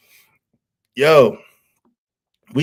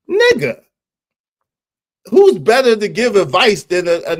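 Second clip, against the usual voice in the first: incredulous; 6 semitones higher, 3 dB louder than usual. Real, not acted. Incredulous reply to chat.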